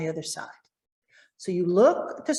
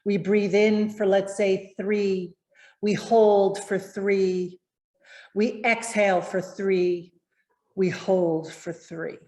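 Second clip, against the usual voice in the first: slow counted breathing; slower than usual. Shown as a demonstration. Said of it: "practice breathing is something called box breathing".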